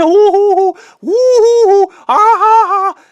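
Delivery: high, loud spooky hoots and laugh; mocking ghost hoots. Friend teasing with ghost noises, high loud hoots. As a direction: hoot like a cartoon ghost to tease. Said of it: "he just kept doing that".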